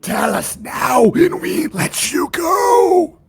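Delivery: slow, whispery creature demand climbing to a high wail. Acted. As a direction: draw out the demand like an eerie creature.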